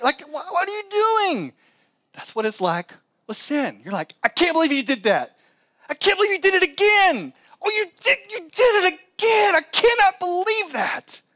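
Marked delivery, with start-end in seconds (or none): 0.0-1.5 incredulous. Retold or imitated. Mocking self after a foolish mistake. 4.2-11.2 much higher and louder, scolding yourself in disbelief; mock self-scolding, voice pitched way up; exasperated, almost wailing at yourself for doing it again. Scolding oneself over repeated sin.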